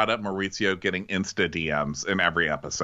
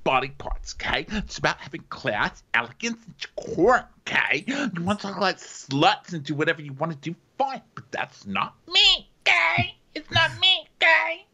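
high-pitched mock prudish protest, escalating; hammed-up prudish indignation, climbing to a squeal; Ramona; 9 semitones higher than usual. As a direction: imitate a prim objector getting ever shriller. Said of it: "arbitrary prudishness kicks in".

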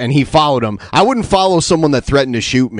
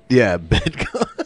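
laughing; 3 semitones lower, 4 dB quieter, much slower than usual. Real, not acted. Teasing the slip, laughing.

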